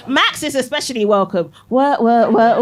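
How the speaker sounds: usual speaking voice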